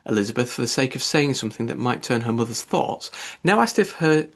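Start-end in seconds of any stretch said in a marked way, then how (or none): none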